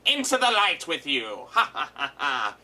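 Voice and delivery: villain voice ending in a cackle; hammed-up villain taunt and laugh; gloating villain. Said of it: "It's like".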